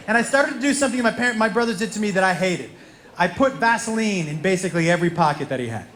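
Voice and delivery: usual speaking voice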